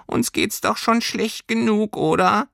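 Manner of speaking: high-pitched little creature complaint; squeaky furry creature. A narrator's reading. Creature grumbling to girl that both feel bad, squeaky. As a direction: pipe it up, ending on a questioning tag.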